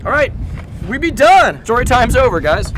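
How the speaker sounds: high, loud playful call; loud announcement. Real, not acted. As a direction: call out that it's over to everyone. Announcing the end loudly.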